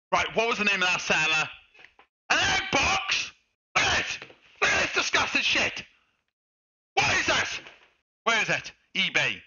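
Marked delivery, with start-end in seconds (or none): 2.3-7.6 high outraged shout; disgusted yelling. Real, not acted. Streamer yelling at the egg box on camera. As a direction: rant in disbelief at the box. Prank victim ranting on stream.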